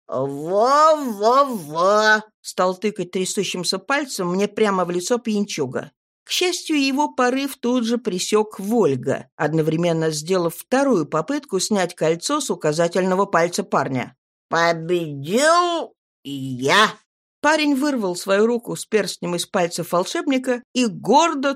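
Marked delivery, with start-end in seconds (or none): 0.1-2.2 put-on slow slurred drunken babble; a tipsy lad agreeing eagerly; town drunk. A narrator's reading. Drunk pointing shakily. 14.5-16.9 put-on slow slurred drunken boast; proud tipsy lad bragging; town drunk. A narrator's reading.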